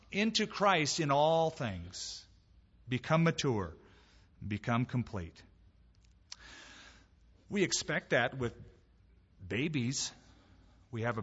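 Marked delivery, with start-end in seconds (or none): none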